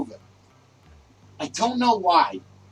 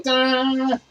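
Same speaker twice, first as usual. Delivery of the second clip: ta-da; 6 semitones higher than usual. Acted. Host presenting with flourish.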